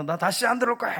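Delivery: high-pitched retold outburst; mock yell. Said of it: "이러면서 막 소리를 지르고 나가는".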